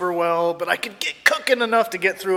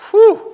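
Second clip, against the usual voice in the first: loud, high exhaled whew; relieved whew; 12 dB louder than usual. Retold or imitated. Snowboarder just missed the girl, loud whew of relief. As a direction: blow out a big whew. Relief after narrowly missing her.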